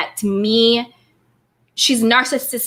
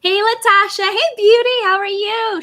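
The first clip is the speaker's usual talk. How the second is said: excited greeting; 9 semitones higher, 3 dB louder than usual. Real, not acted. Greeting chat members.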